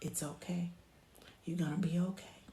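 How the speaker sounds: very soft, soothing murmur; hushed comfort for an upset baby; near-whispered reassurance with pauses; gentle. Shown as a demonstration. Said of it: "just kinda gently say".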